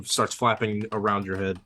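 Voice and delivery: usual speaking voice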